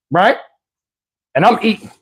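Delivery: ordinary talk, nothing marked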